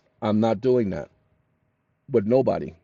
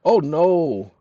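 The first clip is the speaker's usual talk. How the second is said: ordinary delivery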